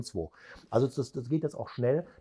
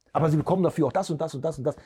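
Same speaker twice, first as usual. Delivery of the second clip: rapid, higher run-on list; mimicked over-talking seller; 4 semitones higher, much faster than usual. Trainer mimics seller talking on after the price, rattled off. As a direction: imitate a seller rattling on without pausing.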